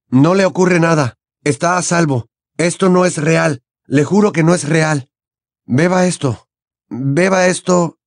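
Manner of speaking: put-on anxious, insistent boy; Harry, soothing and urging. A narrator's reading. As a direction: reassure him and coax him to drink.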